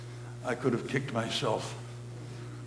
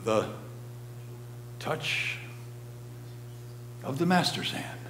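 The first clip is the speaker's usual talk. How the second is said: soft, hushed whisper; 3 semitones higher, 7 dB quieter than usual. A narrator's reading. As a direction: breathe it out reverently. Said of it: "Whisper again".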